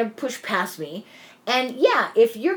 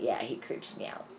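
hushed whisper, much softer; whispered aside; 9 semitones lower, 13 dB quieter than usual. Retold or imitated. Amanda confiding about the creepy driver, whispered. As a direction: whisper it like a secret.

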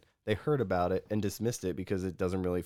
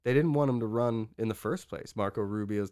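ordinary talk

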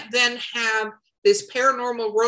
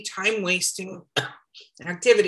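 coughing mid-word; 3 semitones lower than usual. Real, not acted. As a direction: talk through a cough. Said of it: "As I'm coughing".

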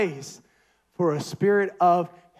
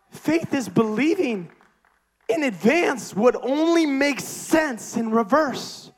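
slower, higher, every word weighted; slow emphatic repeat; 6 semitones higher, slower than usual. Shown as a demonstration. Preacher repeating his key line slowly for the congregation. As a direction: let the line land slowly, each word given weight. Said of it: "But faith is believing in advance".

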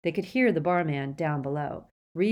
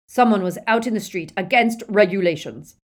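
loud, higher official-sounding charge; raised-voice accusation; stern accusation; 4 semitones higher, 10 dB louder than usual. A narrator's reading. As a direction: announce the offence forcefully. Death Eater accuses the barman.

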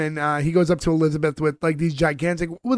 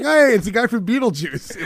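higher-pitched, re-enacted happy recognition; retold excitement; 6 semitones higher than usual. Host recalling seeing the guy from Beetlejuice, playfully excited. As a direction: replay your excited spotting of an actor. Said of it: "I was all excited".

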